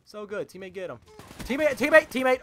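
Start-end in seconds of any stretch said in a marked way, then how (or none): 1.4-2.4 loud, high, repeated shout for help; urgent calling. Real, not acted. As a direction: yell for your teammate's attention.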